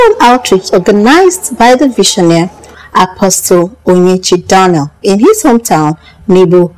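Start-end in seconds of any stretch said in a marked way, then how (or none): none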